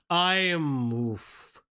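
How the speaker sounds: ordinary talk, nothing marked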